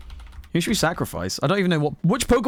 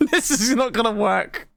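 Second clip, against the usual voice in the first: higher, laughing through the words; laughing doubt; 8 semitones higher than usual. Real, not acted. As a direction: say it straight out of a real laugh. Laughing at own silly move.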